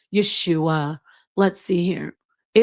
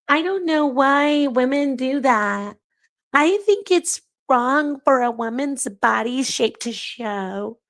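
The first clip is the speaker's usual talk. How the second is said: higher, sugary imitation of a pious churchwoman; mock the woman they want her to be, voice pitched up; 6 semitones higher than usual. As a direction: she parodies the modest believer her critics want, in a high sweet voice. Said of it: "They want me to sit up here and say".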